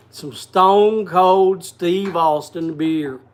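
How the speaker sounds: slow, drawn-out Texas wrestler growl; slow mock Texas drawl of a wrestler; Stone Cold Austin impression. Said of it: "You gotta say it in a stone cold accent".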